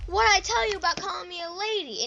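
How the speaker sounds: high, loud indignant comeback; indignant retort. Retold or imitated. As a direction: snap it back like a scolding. Retold angry lady telling boy off.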